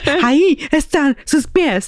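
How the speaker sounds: "Ahí están sus pies" high, word by word; insistent retort. Retold or imitated. Daughter argues back at dad, emphatic "Ahí están sus pies".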